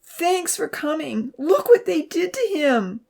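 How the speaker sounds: high, drawn-out distraught wail; high-pitched upset cry. A narrator's reading. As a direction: wail to a friend who arrived. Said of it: "Abby wailed".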